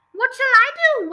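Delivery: high, loud wail; storyteller's despairing wail. A narrator's reading. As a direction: cry out in helpless panic. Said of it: "wailed the father".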